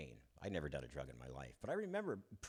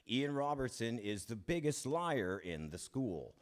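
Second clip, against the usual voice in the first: louder, slower mock announcer call; imagined PA announcement; 6 semitones higher, 6 dB louder, slower than usual. Imagined vice principal on the PA, slow mock announcement. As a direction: announce it slowly like a public shaming. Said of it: "he's gonna say over the P A system".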